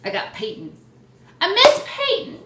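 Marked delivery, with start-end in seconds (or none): 1.4-2.4 high, loud cry of longing; big high fangirl outburst; gushing wail. Real, not acted. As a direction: wail it out loud like a devoted fan. Gushing over a missed player.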